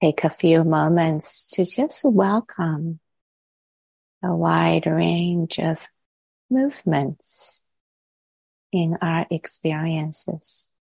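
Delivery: calm, unhurried meditation voice with long pauses; guided meditation. Real, not acted. Teacher settling the group into body and breath, calm guided meditation. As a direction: guide them gently inward, leaving long silences between phrases.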